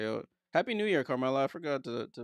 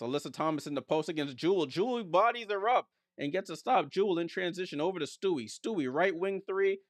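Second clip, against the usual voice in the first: higher, excited basketball call; animated courtside commentary; live play-by-play; 6 semitones higher, 3 dB louder than usual. Real, not acted. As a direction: ride the fast break with rising energy.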